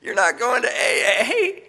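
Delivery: high, slow, laughing scoff; laughing mockery. Sarcastic brother laughing at his AA plan. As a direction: laugh it off as absurd. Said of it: "and he laughed".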